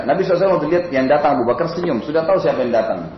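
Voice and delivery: ordinary talk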